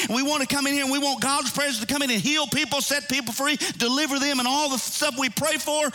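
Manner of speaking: high-pitched, fast preaching run; high sustained pulpit cadence. Real, not acted. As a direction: preach it in one rapid, driving stream.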